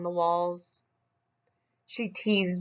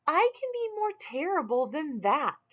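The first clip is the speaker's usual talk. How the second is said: much higher brag; high boast; 10 semitones higher than usual. Acted. Smug boasts to Claus in a much higher voice.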